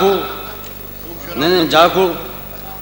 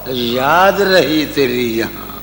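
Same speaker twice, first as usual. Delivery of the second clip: tender romantic poetry. Acted. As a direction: recite it lovingly, slowing down. Recites ghazal couplets.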